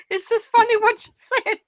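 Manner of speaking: giggling high through the words; real laughter breaking up the line; speaking through laughter; laughing. Real, not acted. Laughing at friend's Wales joke.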